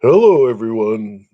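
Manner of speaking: lightly voiced radio host greeting; radio announcer voice. Shown as a demonstration. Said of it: "A radio".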